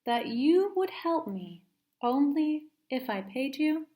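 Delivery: a hint of a glaring mother; stern mom. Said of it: "Now she was glaring".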